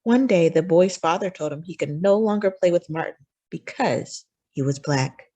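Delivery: usual speaking voice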